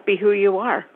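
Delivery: ordinary delivery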